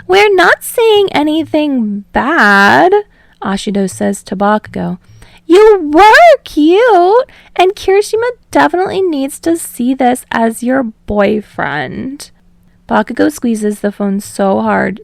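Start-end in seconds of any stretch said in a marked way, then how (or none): 0.1-2.9 put-on much higher, slow, playful teen girl; bubbly girl voice. Ashido voiced. 5.4-12.3 put-on bubbly girl voice. Ashido voiced.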